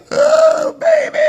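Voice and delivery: very high, loud cry of relief; overjoyed cry. Retold or imitated. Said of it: "immediately am like".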